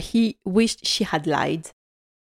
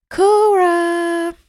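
excited reveal; 10 semitones higher, 5 dB louder than usual. Real, not acted. Naming the obvious helper.

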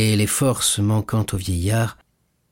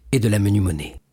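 ordinary delivery, nothing marked

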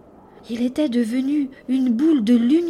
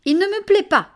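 put-on defiant child; 9 semitones higher, 6 dB louder, faster than usual.

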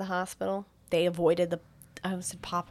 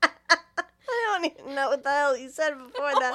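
laughing; 5 semitones higher than usual. Real, not acted. Laughing at friend's ramble.

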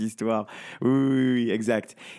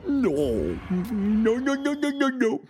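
put-on high whining toddler king; whiny baby king; 8 semitones higher, slower than usual. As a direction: whine like a sulky child. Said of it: "Il réagit comme un bébé".

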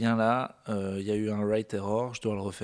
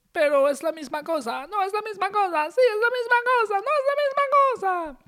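put-on rising, high and slow Italian-accented mother; 4 dB louder, slower than usual. Mother arguing with her son about burritos, Italian accent. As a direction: argue back and forth, climbing higher each time. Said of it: "j'ai un accent italien".

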